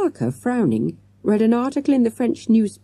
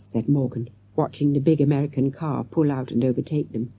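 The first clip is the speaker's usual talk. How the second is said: ordinary delivery, nothing marked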